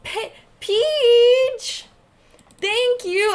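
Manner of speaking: high, loud burst of thanks; excited thanks. Real, not acted. Streamer thanking Peach for a tip, excited and loud. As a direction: gush thanks at the donor.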